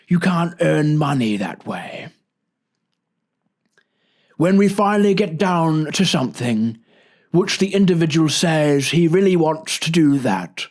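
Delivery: unhurried Attenborough-style reading; David Attenborough impression. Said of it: "I'm doing David again".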